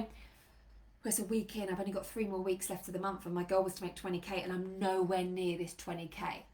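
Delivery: low, soft, deflated worry; sinking, subdued inner voice; self-doubting. Acted. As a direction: play someone losing faith in their goal. Acting out creeping self-doubt midmonth.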